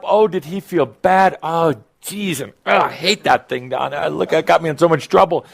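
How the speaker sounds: hammed-up fretting over trouble; mock exasperation. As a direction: act out an exasperated complaint. Voices a man's past complaints.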